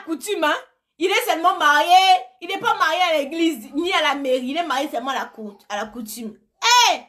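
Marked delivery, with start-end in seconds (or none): none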